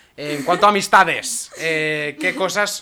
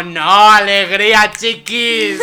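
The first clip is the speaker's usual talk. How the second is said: loud, high, slow and laughing; cheery loud exclamation; 6 semitones higher, 9 dB louder, much slower than usual. Acted. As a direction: pump everyone up for summer. Cheering up the gloomy chat.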